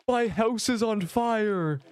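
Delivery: high, slow, panicked wail; panicked shriek. Pretend. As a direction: cry it out like a hammed-up disaster. Mock panic over burning house.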